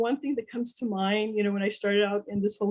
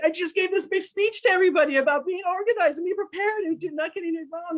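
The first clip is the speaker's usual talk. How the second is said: much higher, exasperated at herself; self-scolding disbelief; 10 semitones higher than usual. Retold or imitated. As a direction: berate yourself in disbelief. Scolding herself after missing recording.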